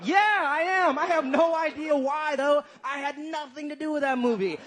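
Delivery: high, gushing sarcastic excitement; mock enthusiasm. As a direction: agree with exaggerated, over-bright delight. Sarcastic answer to interviewer.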